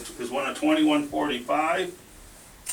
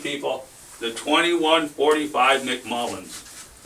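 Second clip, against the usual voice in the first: usual speaking voice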